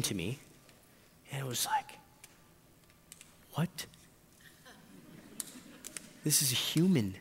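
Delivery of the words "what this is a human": softly stunned, halting; new parent's awed, hushed voice; hushed astonishment. Retold or imitated. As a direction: murmur it in quiet disbelief. New father stunned, holding his baby.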